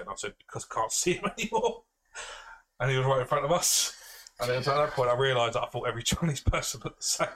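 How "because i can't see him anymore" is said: mock-upset whine rising to the end; retold upset wail. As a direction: voice climbing high, half laughing. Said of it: "and I got really upset".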